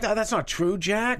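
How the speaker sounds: high, pleading protest, lightly acted; mock pleading Bob. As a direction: squeak out the denial to flatter him. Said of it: "and he was like".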